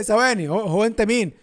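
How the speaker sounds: usual speaking voice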